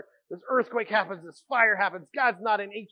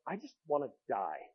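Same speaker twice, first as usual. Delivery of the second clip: despairing; 6 semitones lower, 9 dB quieter than usual. Retold or imitated. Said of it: "he's like".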